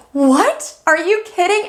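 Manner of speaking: a friend's excited disbelief; stoked friend. Retold or imitated. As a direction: play the delighted friend who can't believe it. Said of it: "they're so stoked".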